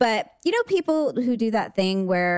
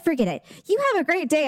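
mock dismissive woman; 7 semitones higher, faster than usual. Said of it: "She's like".

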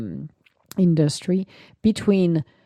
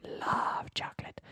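whisper; 12 dB quieter than usual. Acted. Repeated slogan, whispered softer.

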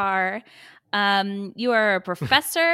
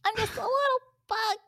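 very high tiny bug voice; little bug voice; 14 semitones higher, much faster than usual. Pretend. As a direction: squeak like a little cartoon bug. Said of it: "your voice of the nerdy and then the little bug".

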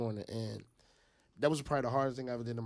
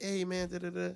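high, enthused sales opener; hyped-up greeting; 7 semitones higher than usual. Retold or imitated. Fundraiser to the next car, pumped up and hopeful. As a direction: chirp the pitch, trailing into filler syllables. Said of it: "I'm pumping myself up to get ex".

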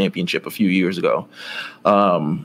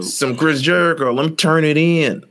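put-on light country accent, a little higher; 6 semitones higher than usual. Roadside finder handing in the belt, country voice. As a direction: put on a hint of country twang. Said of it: "I love your country voice".

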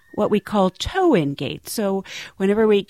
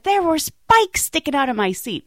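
higher-pitched indignant complaint; mad passenger; 8 semitones higher than usual. Retold or imitated. Mad passenger shows crew the hole in his pants, indignant. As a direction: imitate an outraged passenger insisting. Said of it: "you can just tell he's mad".

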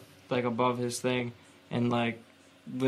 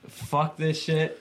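slow shaken curse; 4 semitones higher, much slower than usual. Retold or imitated. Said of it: "I was like".

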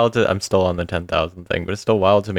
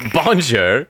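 mock French bonjour; 5 semitones higher than usual. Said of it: "doesn't have a British accent".

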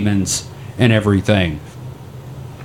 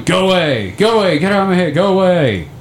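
half-acted frantic shouts; retold yelling; 8 semitones higher than usual. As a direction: shoo away the visions, urgently. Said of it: "And I just start yelling".

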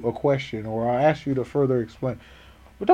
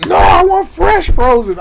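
high, loud, slow mock shout; yelling customer; 13 semitones higher, 8 dB louder, slower than usual. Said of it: "He's yelling".

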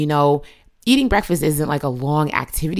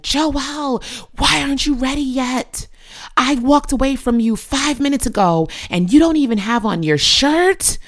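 high, pitched-up retold yell at her son; an exasperated mom's mock yell, pitched high; mock screaming; 10 semitones higher than usual. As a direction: replay herself yelling at Joelle, higher. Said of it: "I would be screaming".

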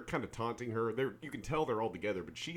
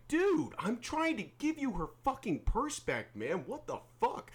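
high indignant yelling; high-pitched outraged retold shout; retold angry yell; 12 semitones higher, slower than usual. Retelling yelling at jacked guy.

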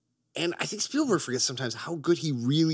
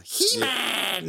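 Skeletor voice; 12 semitones higher than usual. Retold or imitated. Naming Skeletor voice actor.

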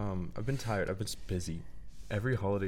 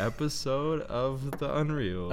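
announcer voice; 6 semitones higher, slower than usual. Pretend. Announcing the show title.

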